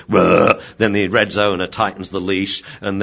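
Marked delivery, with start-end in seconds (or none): 0.1-0.6 loud mock dog growl; a sharp loud growl; imitated growl. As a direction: growl back like a cornered dog. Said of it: "who's growling at me".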